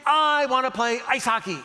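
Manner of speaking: lightly voiced small son pleading; excited little kid. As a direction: ask like a six-year-old begging his dad. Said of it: "he goes".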